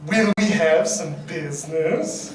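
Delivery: low, slow, laughing seductive queen; sultry low mock-queen delivery; better Cersei read. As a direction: drop the voice low and drag it out. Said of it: "you need to say that better".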